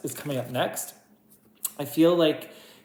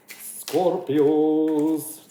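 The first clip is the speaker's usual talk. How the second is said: drawn-out call; 6 dB louder than usual. Shown as a demonstration. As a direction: stretch Scorpios out slowly and louder, like calling a name. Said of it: "I wanted to say it like that".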